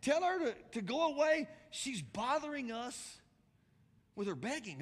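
high, exasperated disciples' complaint; urging. Retold or imitated. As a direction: act out fed-up followers, loud then easing off. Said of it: "they urged him".

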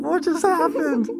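high-pitched, bewildered mock reaction; stunned disbelief. Acting out his stunned reaction.

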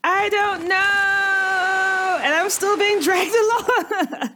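high, slow shout; shouting while dragged. Acted. Dragged character shouts back.

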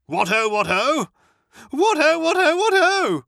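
Motty's giddy chant of what ho, high and loud; exuberant repeated greeting. Acted. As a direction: crow it gleefully, climbing up.

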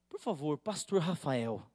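imitating a colleague's solemn invitation to pray; mock seriousness. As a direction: ask with exaggerated gravity. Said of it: "Com essa seriedade assim".

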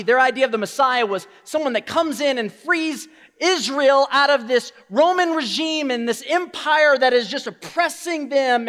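normal talking voice

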